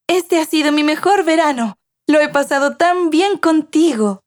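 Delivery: put-on high-pitched child voice, delighted; happy little granddaughter. A narrator's reading. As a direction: voice a happy girl talking to her grandma. (voz aguda de niña contenta)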